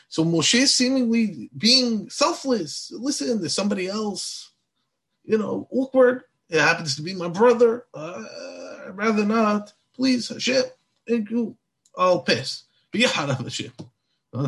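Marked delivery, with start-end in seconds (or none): 2.9-12.7 high-pitched, halting polite excuses; awkward refusal. Retold or imitated. Moshe begs off the mission to God, awkwardly reluctant.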